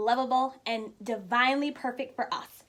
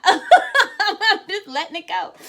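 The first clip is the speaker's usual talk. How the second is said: laughing through the words, slower; 5 semitones higher, 9 dB louder, slower than usual. Real, not acted. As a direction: let the laugh carry it. Laughing through her own remark.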